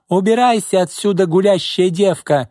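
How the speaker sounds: high-pitched hysterical abuse; hysterical yelling. A narrator's reading. Boss hurls abuse at departing secretary, hysterical. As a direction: rage at her, voice way up. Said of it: "истерично завопила Изольда".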